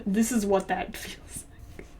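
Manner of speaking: whispered aside; a hushed, deadpan whisper. Real, not acted. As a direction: breathe it out in a whisper. Mock aside about bad writing.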